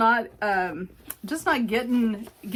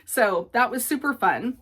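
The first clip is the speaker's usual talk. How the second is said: ordinary delivery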